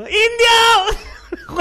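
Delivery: high laughing shout. Retold or imitated. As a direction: yell it out across the room. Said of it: "de gritar así como".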